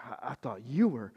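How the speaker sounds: fast, soft befuddled rambling; confused king. Retold or imitated. Befuddled king asking Elisha what to do, quick and soft. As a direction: stumble like you're lost and clueless. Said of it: "this confused, befuddled look".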